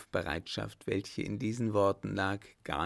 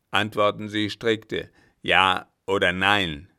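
put-on loud, domineering old captain barking orders; angry captain; 7 dB louder than usual. Captain demands obedience from the German, loud and domineering. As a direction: thunder at him like a tyrant master.

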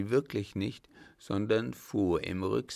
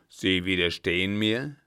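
louder, affronted question; indignant captain; 6 dB louder than usual. Acted. Captain affronted that the German resists, louder. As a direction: bristle at the cheek.

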